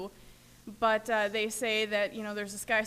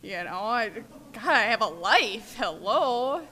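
slow, then louder indignant whine; mock protest; 6 dB louder, slower than usual. Newcomers refusing God's purpose, louder mock complaint. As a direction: play the ungrateful newcomer. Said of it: "we say".

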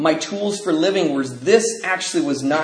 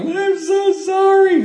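put-on high, slow, pleading small boy; timid little kid; 4 dB louder, much slower than usual. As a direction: stretch it out like a sorry five-year-old. Child apologizing before punch.

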